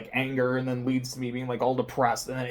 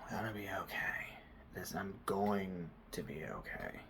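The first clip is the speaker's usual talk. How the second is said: whispered self-reassurance; 4 semitones lower, 16 dB quieter than usual. Retold or imitated. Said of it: "and I'm like".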